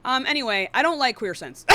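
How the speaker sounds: ordinary delivery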